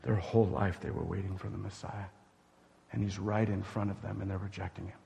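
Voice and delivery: low, soft and grave; sober hush. Real, not acted. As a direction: let the weight sink in, low and soft. Preacher drops to sober hush.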